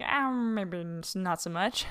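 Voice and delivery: drawn-out, reluctant doubtful mumble; hesitant doubt. Retold or imitated. Host quoting own growing doubts about dominance theory, hesitant.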